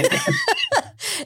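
shrieking high laugh; helpless high-pitched laughter. Real, not acted. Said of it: "se nos muere [name]".

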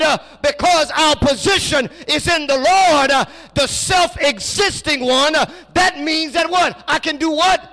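loud, high, call-and-response preaching; preaching at full force. Acted. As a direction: drive the point home to the church. Preacher calling and answering congregation.

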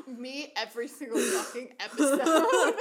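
loud, high laughter through the words; laughing. Real, not acted. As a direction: crack up at your cohost. Laughing at cohost's slip.

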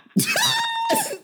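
very high squawking screech; pterodactyl screech. Acted. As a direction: screech like a prehistoric bird. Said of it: "Not this pterodactyl ass noise".

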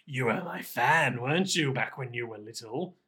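low, softening, menacing puppet voice; quietly creepy storybook villain; sinister Pinocchio. Acted. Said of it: "sinister Pin-, Pinocchio turns his head".

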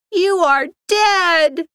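high, drawn-out shriek. A narrator's reading. Kim realizing what the letters spell, shrieking. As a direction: stretch each word in a horrified cry. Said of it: "Kim shrieked".

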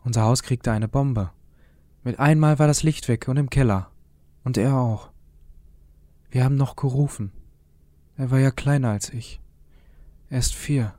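put-on a small boy insisting his brother is still there; child's voice, a hint of desperation; young boy Jürgen. A narrator's reading.

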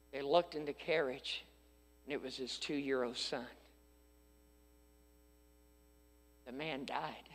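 1 hushed solemn telling. A narrator's reading. Solemn hushed story climax.

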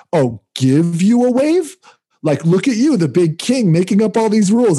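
high, slow sneering imitation; mocking the angry surfer. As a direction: mimic him scoffing, pitch way up.

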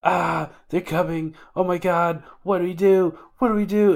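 mock-panicked girl wailing what do we do; freaking out. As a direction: scream it like the enemy's at the door. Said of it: "And she's freaking out".

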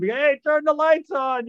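high, loud call from the next room; retold yell. Friend on the toilet yelling for the lights, high and loud. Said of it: "Boddicker was yelling".

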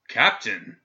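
loud cutting shout; sharp shout. A narrator's reading. Governor shouting back to silence the prisoner. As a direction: cut him off hard. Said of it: "the governor shouted back".

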